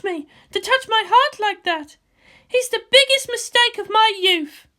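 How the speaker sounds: a tense commander on the eve of battle; Misato's voice. Acted. Misato brooding to Ritsuko about tomorrow, high and loud.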